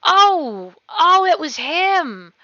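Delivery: high, slow shocked gasp; gasping surprise. Acted. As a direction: gasp as you recognize him. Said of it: "both gasped".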